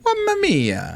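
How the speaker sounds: put-on high "Mamma mia" in Mario accent; squeaky Italian plumber exclamation. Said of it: "Chris Pratt does the voice".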